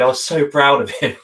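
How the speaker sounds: proud words through a laugh; tearful happy laugh; laughing with pride. Real, not acted. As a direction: laugh while saying it. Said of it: "I almost cried".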